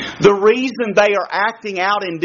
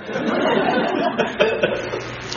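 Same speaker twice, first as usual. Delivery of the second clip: breathy laugh; 5 dB quieter than usual. Real, not acted. Laughs at own story.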